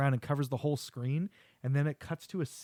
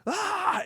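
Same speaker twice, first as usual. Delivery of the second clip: high-pitched acted "ah" scream; imitated high attack cry; mock attack scream. Devil attacking the old lady, mock scream.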